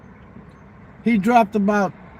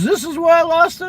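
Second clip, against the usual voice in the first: surprised high question; 10 semitones higher, 5 dB louder than usual. Retold or imitated. Gambler hearing money was found.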